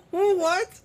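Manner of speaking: high, soft, laughing what; real disbelief. Real, not acted. Reading the parrot story, startled and giggling. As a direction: caught off guard by a news line, laughing. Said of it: "What the fuck did I just read?".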